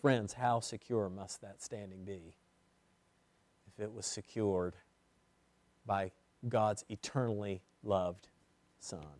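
soft, low pleading question; hushed earnest question. Acted. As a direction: drop to an intimate hush. Quiet intimate question.

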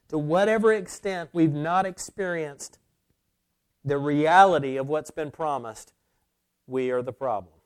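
loud, weighty pulpit voice; emphatic, full-voiced preacher; forceful preaching. Real, not acted. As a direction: press the admission hard on the congregation.

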